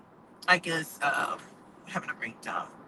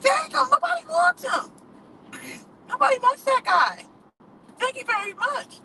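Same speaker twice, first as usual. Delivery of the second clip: sassy retort; 11 semitones higher, 3 dB louder than usual. Retold or imitated. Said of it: "I'm like".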